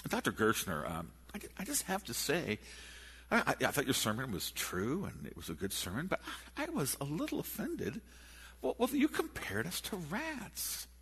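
high mock offended lady voice; offended woman. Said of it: "she comes forth and she goes".

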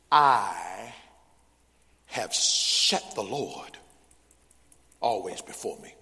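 slow and low, loud held "I"; deliberate scripture reading, easing off at the end; solemn verse recitation. Acted. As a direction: declaim it in spaced-out phrases. Declaims verse to congregation.

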